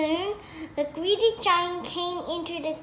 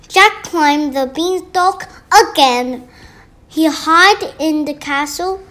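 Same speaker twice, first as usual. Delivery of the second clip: ordinary delivery, nothing marked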